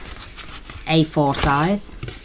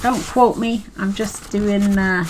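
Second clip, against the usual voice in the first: put-on a slipped-in Scottish accent, pitched higher; unplanned Scottish accent while crafting; 5 semitones higher than usual. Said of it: "talking with a Scottish accent".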